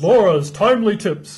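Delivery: slow, big radio announcer read. Pretend. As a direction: announce it like a show segment. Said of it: "My announcer voice".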